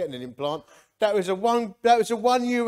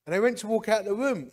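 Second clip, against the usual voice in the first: ordinary talk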